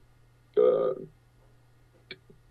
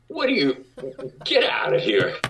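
incredulous; 9 semitones higher than usual. Retold or imitated. Director reacting to the actor's claim, high laughing disbelief. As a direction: squeal get out of here in disbelief.

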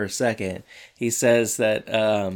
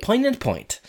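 "Poignant point" said high with a falling end; exaggerated high "Poignant point"; high dramatic take; 11 semitones higher than usual. Shown as a demonstration. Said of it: "That was terrible".

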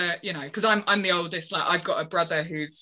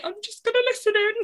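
quick, sweet little high voice; high-pitched mum quote; 14 semitones higher, faster than usual. Retold or imitated. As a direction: imitate mum piping up from the background. Mum shyly listening in, voiced.

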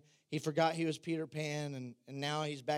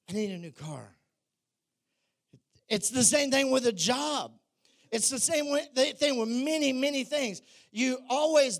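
raised, energetic sermon voice; preaching; 6 semitones higher, 5 dB louder than usual. Acted. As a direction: drive the point home to the congregation. Preaches to congregation.

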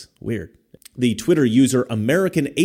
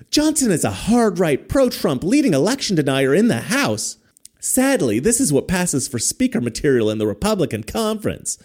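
higher, whiny congressman voice; mock Schiff voice; 6 semitones higher than usual. Vlogger keeps mocking Schiff's tweet in a higher whine. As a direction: read it as a smug politician. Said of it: "That's my Adam Schiff impression".

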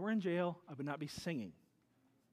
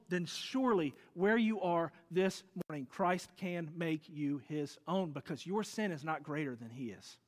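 ordinary delivery

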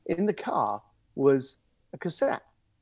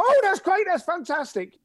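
much higher and louder, matching their excitement; matching enthusiasm; 14 semitones higher, 5 dB louder than usual. Shown as a demonstration. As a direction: mirror their excitement right back. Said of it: "match it with that enthusiasm".

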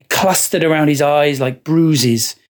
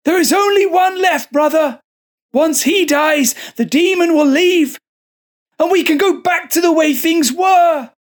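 much higher, shouted mad monk; frenzied shout; 13 semitones higher than usual. Acted. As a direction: rave it at him, half mad. Possessed monk shouts at brother.

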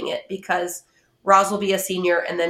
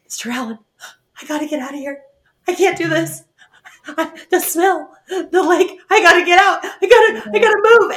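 rising panicky plea, getting higher and louder; a kid desperate to escape the smell, building to a shout; panicked kid; 8 semitones higher, 5 dB louder, faster than usual. Retold or imitated. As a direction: panicky voice climbing to a loud, high plea.

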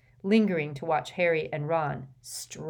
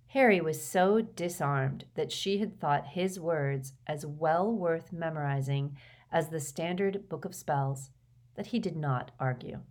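usual speaking voice

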